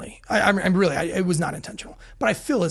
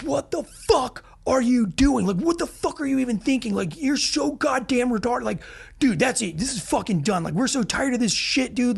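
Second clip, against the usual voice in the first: exasperated telling-off; 6 semitones higher than usual. Retold or imitated. Acts out team berating him.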